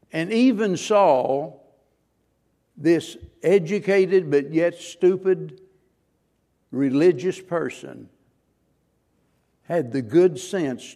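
slow, loud, weighty sermon delivery; emphatic preaching. Acted. Preacher describing Saul to the church, slow and weighty. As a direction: lean on each phrase.

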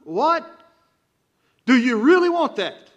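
exasperated outcry. Pretend. Preacher pretending to halt a rote prayer: loud exasperated outcry. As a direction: throw up your hands and stop them. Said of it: "I want to throw up my hands and say".

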